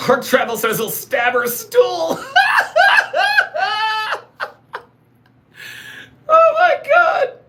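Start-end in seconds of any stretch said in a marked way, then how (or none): 0.8-2.2 breaking into laughter. Real, not acted. Cracking up while reading chat. 2.2-4.5 loud, very high cackle; helpless squealing laughter; real laugh. Real, not acted. Cracking up at crude chat. 6.3-7.4 slow, loud, very high squeal; laughing squeal. Real, not acted. Breathless from laughing at joke.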